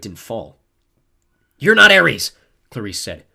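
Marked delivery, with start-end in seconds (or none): 1.6-2.3 high, loud challenge; angry, emboldened denial; defiant shout. A narrator's reading. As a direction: call out an impostor forcefully. Clarice defies impostor in fight.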